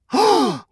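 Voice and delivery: sharp, loud excited gasp; gasp of realization. Acted. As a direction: gasp as the idea hits. Sudden idea.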